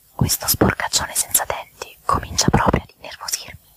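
irritated whispered aside; hushed grumble under the breath; low voice. A narrator's reading. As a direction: whisper it to yourself, annoyed. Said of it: "disse a bassa voce".

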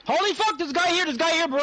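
high, loud alarmed yell; yelling across the room. Real, not acted. Enemy spotted, alarm.

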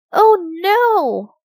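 high, loud cry of alarm; dismayed. Acted. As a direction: exclaim in alarm at a nasty surprise. Gasps, then child's dismayed exclamation.

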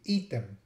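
item said "EE-tem", with the ee held; long Latin I. Shown as a demonstration. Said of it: "I pronounce it a little longer".

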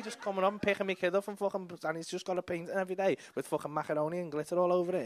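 ordinary talk, nothing marked

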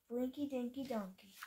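usual speaking voice

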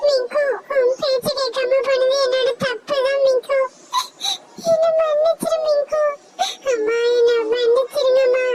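high, sobbing apology to her sister; a child saying sorry through tears; very high tearful child voice; crying apologetic girl. Acted.

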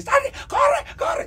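high, loud urgent yelling; frantic high-pitched command, acted out; acted inner-child shout. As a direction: yell it like a panicked inner child. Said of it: "cuándo está gritando".

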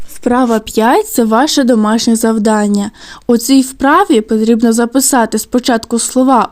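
ordinary talk

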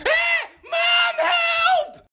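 high screaming for help; panicked kid scream. Acted. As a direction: shriek like a chased boy. Kid fleeing his brother.

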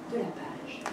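whispered softly, voiceless. Acted. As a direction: breathe it out as a secret. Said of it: "parle tout bas". (chuchoté tout bas)

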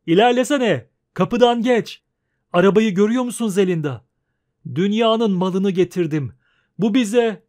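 loud, proud homecoming merchant; excited merchant. Acted. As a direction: boast to your wife from the cart. Merchant Yurga arriving home excited.

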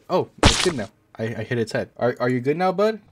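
ordinary delivery

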